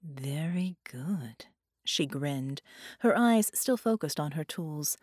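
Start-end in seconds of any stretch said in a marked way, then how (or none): 0.0-1.5 hushed praise. A narrator's reading. Villainess praises captive, whispering.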